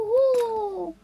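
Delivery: long, loud, high whoosh; drawn-out blowing noise; wind whoosh. Acted. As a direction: imitate a gust blowing. Imitates gust of wind.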